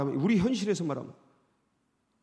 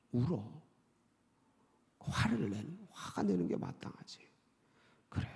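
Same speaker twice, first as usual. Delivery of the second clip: whispered, low tender comfort; the Lord comforting; 5 semitones lower, 7 dB quieter than usual. Acted. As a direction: whisper like a father consoling a hurt child. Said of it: "하고 주님이 그걸 받아주십니다". (속삭이듯 다정하게 위로하는 주님)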